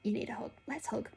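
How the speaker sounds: soft voiceless whisper; whispered comfort. Retold or imitated. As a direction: whisper it kindly to a friend. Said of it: "she was like".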